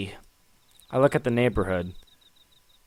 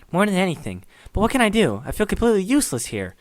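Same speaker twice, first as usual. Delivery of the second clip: put-on a lightly higher, guilt-ridden young man; guilty friend voice; 8 semitones higher than usual. Menji, blaming himself for Sayori, in a lightly raised guilty voice.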